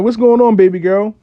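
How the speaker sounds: loud, high-pitched call; retold startled shout-out; exclaimed greeting. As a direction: hail her loudly, voice jumping up. Retells greeting her after surgery.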